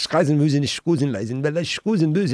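rattled-off mock German. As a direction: imitate a lady talking gibberish German. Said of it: "some lady turns around and goes".

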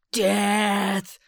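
loud, fierce cry of Death!; battle cry. A narrator's reading. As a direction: shout it as a war cry. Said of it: "cried Bospo".

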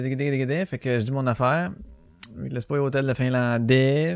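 ordinary talk

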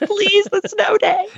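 high, slow pleading; pleading horse voice. Retold or imitated. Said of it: "He says".

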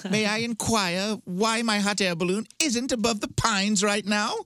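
put-on very high, snooty spoiled rich kid; entitled rich kid. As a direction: whine like a pampered 1800s heir. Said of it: "sound like a rich kid in a hot air balloon".

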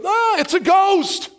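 frightened cry. Acted.

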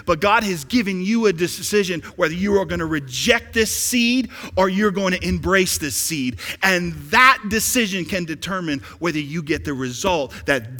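loud, driving sermon delivery; forceful preaching. Real, not acted. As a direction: preach it out loud, hammering key words.

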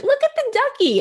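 put-on very high sing-song baby voice; distracting baby talk. Mother waving a squeezy duck at the baby, very high baby talk. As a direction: squeak it way up to pull attention off the tears. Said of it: "gets a squeezy little duck and".

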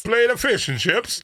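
high, slow, badly faked British; muddled British accent. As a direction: stretch out a clumsy British accent. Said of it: "what accent I'm going for".

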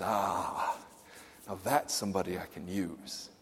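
very soft and low, tender; hushed tender acceptance. Retold or imitated. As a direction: say it gently, like a quiet welcome. Jesus's tender reply.

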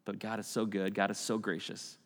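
ordinary delivery

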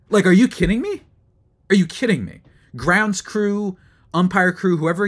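exasperated rant. Real, not acted. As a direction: fume at the umpires, voice climbing high. Ranting at the crews.

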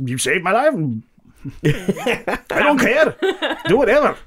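put-on cracked old-timer voice, chuckling; old man voice. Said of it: "The old man thinks it's weird, says".

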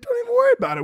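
very high, breezy security guard; cheerful laid-back agent; relaxed Florida guard waving him through; friendly guard. Retold or imitated. Friendly small-airport security.

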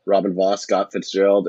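normal talking voice

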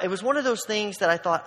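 ordinary talk, nothing marked

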